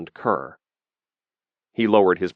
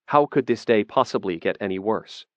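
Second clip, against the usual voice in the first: usual speaking voice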